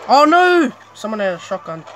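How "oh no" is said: loud, high cry of dismay; alarmed cry. Real, not acted. Player suddenly meets an enemy, alarmed cry. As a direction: yelp it, caught out.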